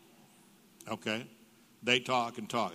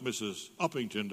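usual speaking voice

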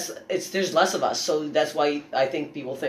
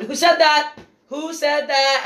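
much higher and louder, calling out into the crowd; retold yell at heckler; 11 semitones higher, 6 dB louder, slower than usual. Comedian retells confronting a woman in the crowd, loud mock yell. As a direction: demand to know who said it, mock yell. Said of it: "I went".

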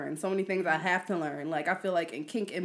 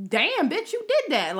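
hyping herself up; 11 semitones higher, 3 dB louder than usual. Retold or imitated. Self-hype quote.

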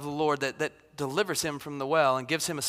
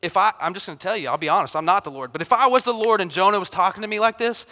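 usual speaking voice

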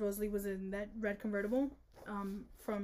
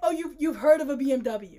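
loud, mocking disbelief; mock incredulous; 3 semitones higher, 7 dB louder than usual. Mocking the book heroine, loudly incredulous. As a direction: sneer back the line in mock shock.

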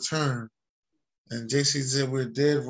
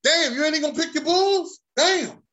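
high, loud disbelief; shocked; 12 semitones higher, 5 dB louder than usual. Real, not acted. Host shocked that his co-host won't pick his own Bulls, loud and high.

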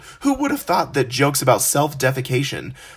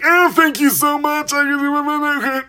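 much higher, excited gush of thanks; squealing starstruck thank you; much slower than usual. Retold or imitated. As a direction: starstruck fan overflowing with thanks.

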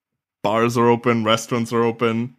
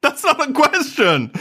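excited protest. Real, not acted. Catching co-host out, laughing.

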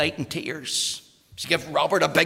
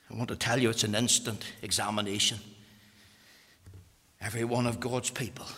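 usual speaking voice

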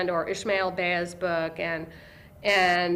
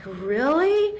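hopeful surprise; 7 semitones higher, 5 dB louder than usual. Retold or imitated. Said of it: "she was like".

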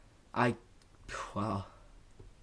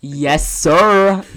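loud, higher-pitched hype-man reply; hyped-up shout; 5 semitones higher, 12 dB louder than usual. Pretend. Co-host answering the host's introduction, loud and hyped.

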